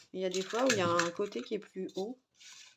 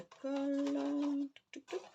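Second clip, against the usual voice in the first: playful singsong; 7 semitones higher, slower than usual. Pretend. Playful sound words while gluing.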